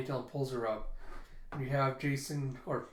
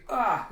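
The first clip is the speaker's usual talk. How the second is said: short, high, loud agonized cry; acted burst of pain; pained scream; 8 semitones higher, 8 dB louder than usual. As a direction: cry out as if pulling metal from a wound. Pulls metal from thigh.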